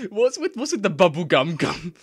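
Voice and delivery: high, loud disbelief; baffled outburst; surprised. Real, not acted. Incredulous at translation.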